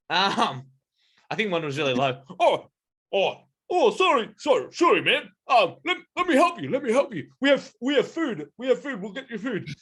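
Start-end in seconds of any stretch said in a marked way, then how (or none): 2.4-9.7 high, shocked gorilla apologising; eager, repentant creature voice; flustered gorilla. Acted. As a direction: fall over yourself to help in a high put-on voice. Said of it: "completely shocked out of their anger".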